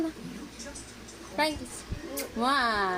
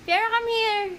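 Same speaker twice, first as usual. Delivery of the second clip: calling out; 6 semitones higher than usual. Real, not acted. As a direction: call the dog over, bright and raised. Calling the dog.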